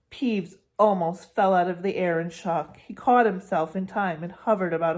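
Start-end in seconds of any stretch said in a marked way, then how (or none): none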